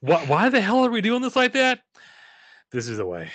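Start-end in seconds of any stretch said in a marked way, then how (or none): 0.0-1.8 mock outrage.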